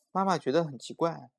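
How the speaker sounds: ordinary delivery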